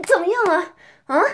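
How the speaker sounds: ordinary talk, nothing marked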